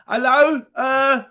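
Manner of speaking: hammed-up Brit talking loudly to foreigners; English tourist abroad. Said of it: "English people, they go on holiday and they say".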